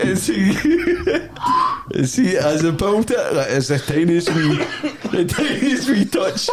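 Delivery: words breaking into laughter; laughing. Real, not acted. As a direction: talk through helpless laughing. Storyteller in laughing fit.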